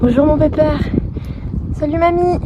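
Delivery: high, sweet pet voice. Real, not acted. Greeting the old ponies.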